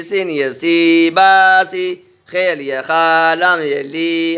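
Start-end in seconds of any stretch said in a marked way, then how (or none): 1.1-2.2 slow blended word. Shown as a demonstration. Teacher showing pupils the joined word दादी, slow and clear. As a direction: stretch दादी out slowly and a bit louder, syllables joined. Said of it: "बा ये बी".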